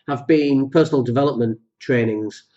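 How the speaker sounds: ordinary talk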